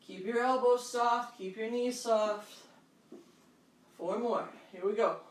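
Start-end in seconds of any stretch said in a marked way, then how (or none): none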